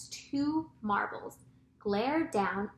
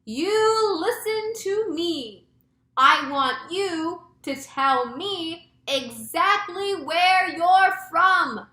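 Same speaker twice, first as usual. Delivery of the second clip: slow, high, loud holler; drawn-out official demand at full volume; shouted demand; 8 semitones higher, 9 dB louder, much slower than usual. A narrator's reading. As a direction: shout each word up to someone far away. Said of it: "cupping his hands to his mouth".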